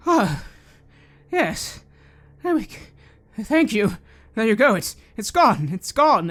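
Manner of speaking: tormented man thanking the caster in relief; relieved man. Acted. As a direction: gush thanks as the torment lifts. Man relieved after dispel.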